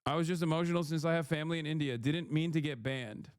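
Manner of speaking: fast flat excuse; rapid readout. Retold or imitated. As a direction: read the excuse out quickly. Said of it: "in context to Kashmir".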